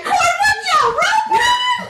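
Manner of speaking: very high, drawn-out mock yell; retold startled yell. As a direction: shriek it slowly in disbelief. Retelling startled reaction to a photo.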